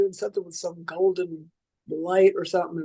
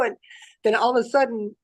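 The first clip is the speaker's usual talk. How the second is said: ordinary talk, nothing marked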